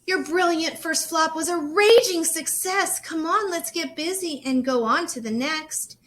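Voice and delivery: high, loud, spirited urging; rally her to keep going; rousing encouragement. Acted. Great aunt urging Rosie on, loud and high.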